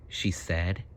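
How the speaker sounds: plain reading voice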